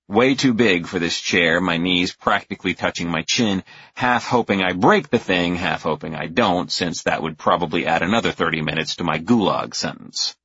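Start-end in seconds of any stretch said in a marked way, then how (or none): none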